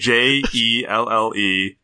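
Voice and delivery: "J E L L E" slowly, letter by letter; spelled out. Shown as a demonstration. Host giving the channel name, spelling "J E L L E" slowly. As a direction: spell "J E L L E" one letter at a time.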